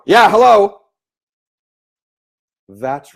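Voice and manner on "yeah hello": much louder, higher, blunt "Yeah, hello"; loud phone answer. Retold or imitated. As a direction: bark it into the phone like a loud caller. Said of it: "if they answer the phone really loud".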